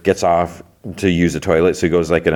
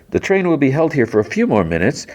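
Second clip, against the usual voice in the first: higher-pitched mock train announcer; PA announcement; 5 semitones higher than usual. Lady worker addressing passengers over the PA, higher announcer voice. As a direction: imitate a public address announcement. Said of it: "talks to the P A".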